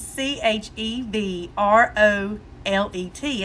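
slow, soft letters spelled out; slow correct spelling. Retold or imitated. Wife spells it right for husband, slow and soft. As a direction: spell it calmly, slowly. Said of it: "I said".

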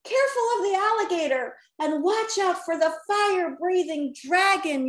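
mildly hammed-up warning yell. A narrator's reading. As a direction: call out an urgent warning. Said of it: "yelled Rocky".

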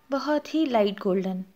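ordinary talk, nothing marked

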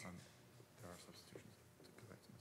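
low whisper to the chair, off mic; hushed whisper prompting the presiding officer; whispered aside. Real, not acted. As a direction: murmur a quiet correction so only the chair hears.

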